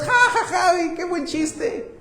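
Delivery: mock laughter. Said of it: "Están riendo, ¿verdad? Gozosos".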